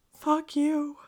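very high, loud squeal of a retort; hammed-up overwrought squeak; mock tearful outburst. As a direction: blurt it in a put-on high wail. Retold tearful outburst at caring guide.

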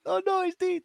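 a high, lightly hammed-up wail; mock wailing. The neighbour sobbing against Damien, mock wailing. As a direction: cry it out high, half-joking. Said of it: "crying up against him".

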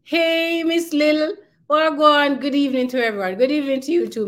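high, loud, delighted hello to a listener; bright shout-out of welcome; excited greeting. Real, not acted. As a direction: call out warmly to a friend who just showed up. Host greets a listener in chat.

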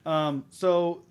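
normal talking voice

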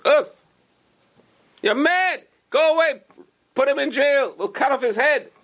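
high, raging outburst; furious emperor. Acted. Emperor opens the box of earth and rages at the rabbi, furious. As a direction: rage at the gift of dirt.

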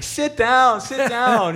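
high put-on Shane voice; mock Shane. [name] urging the fan to sit, in a high mock voice. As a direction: imitate the singer laughing it off. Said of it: "Shane starts saying".

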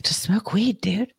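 mock whispered stoner tip; laid-back advice. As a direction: hush it like a chill buddy. Friend's casual advice quoted.

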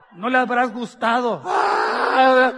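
loud, laughing mock shout from the wife; wife's jealous shout. Wife shouting at husband about the business invite, mock jealous yell.

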